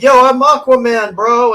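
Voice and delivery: a swaggering bro announcing himself; Aquaman as a bro. Retold or imitated. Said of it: "he's like".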